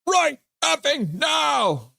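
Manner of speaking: slow, high, loud barked order; a commentator imitating a bench boss yelling; mock coach yell. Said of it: "Swerve and Irvin's like".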